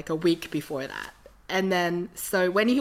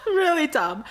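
really dumb said higher through a laugh; 10 semitones higher, 5 dB louder than usual. Real, not acted. Repeats while laughing.

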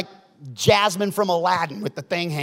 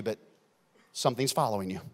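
ordinary talk, nothing marked

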